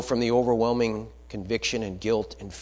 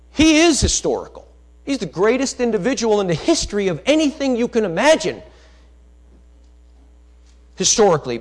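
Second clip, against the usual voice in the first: preaching shout; 10 semitones higher, 8 dB louder than usual. Real, not acted. Preacher declaring Jesus is historical.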